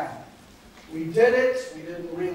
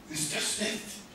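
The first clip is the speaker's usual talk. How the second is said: soft, higher-pitched mock consul voice; imitating German consul; 3 semitones higher, 9 dB quieter than usual. Consul praises the speaker after the show, imitated softly.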